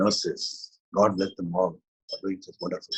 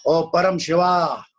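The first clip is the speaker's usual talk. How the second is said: louder, higher call to the god; 9 semitones higher, 8 dB louder, slower than usual. Acted. Teacher calling out to Param Shiva, loud. Calls out to the deity.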